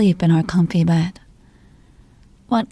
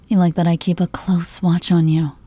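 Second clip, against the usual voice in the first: ordinary talk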